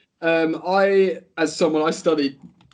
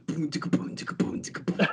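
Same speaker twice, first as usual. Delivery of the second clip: softer, half-whispered drum-beat sounds; imitated drums; 7 dB quieter than usual. Shown as a demonstration. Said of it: "ultra percussion".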